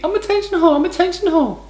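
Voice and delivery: high, sing-song mockery of a show-off; mocking high voice. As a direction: squeal it like a vain attention seeker. Mocking the Instagram poster.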